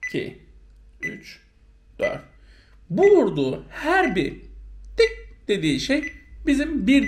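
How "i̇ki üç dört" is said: even, softer count on the beat; steady metronome count. Shown as a demonstration. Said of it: "metronomu ardışık olarak vurur".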